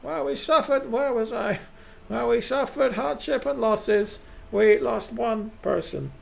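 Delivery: high, ceremonial speech voice; pompous council head. Acted. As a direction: address the colony in a high, grand voice.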